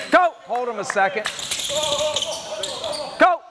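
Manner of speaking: ordinary talk, nothing marked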